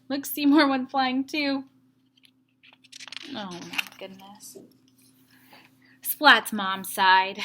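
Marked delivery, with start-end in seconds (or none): 3.3-4.6 Oh my goodness, low and hushed; a tired mother's quiet dismay; soft dismayed sigh. Acted. Mom seeing the leaves everywhere, soft dismay.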